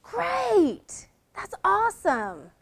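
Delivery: "Great" shot high, genuinely thrilled; delighted great. Shown as a demonstration. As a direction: burst with real joy on "Great". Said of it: "It can be".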